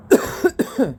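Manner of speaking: hacking smoker's cough; ragged coughing fit; harsh cough. Real, not acted. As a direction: cough it out hard. Coughing after hit.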